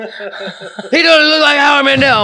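high, loud, hammed-up Queens chatter; mock Queens accent. Host voicing people gossiping about Larry, mock Queens accent. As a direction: play excitable neighbours from Queens. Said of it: "They call me, you know".

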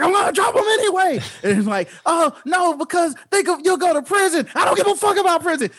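high, defiant mock voice; hammed-up defiance, pitched high. Friend retelling Tiffany's fake threat on the roof, high defiant. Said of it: "she's like".

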